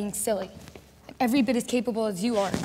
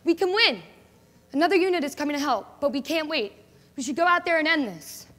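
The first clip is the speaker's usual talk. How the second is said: louder, pumped-up battle cheer; eager rallying call; 4 semitones higher, 5 dB louder than usual. Acted. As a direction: fire up the unit before the charge. Soldier rallying before battle.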